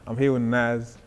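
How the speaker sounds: loud sign-off to camera; hype outro. Acted. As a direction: hype up the followers.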